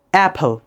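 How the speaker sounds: অ্যাপল as "AP-hul", open a and puffed p; correct English pronunciation. Shown as a demonstration. Teacher models for learners, অ্যাপল with aspirated p. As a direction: pop the p in অ্যাপল. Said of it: "not আপেল".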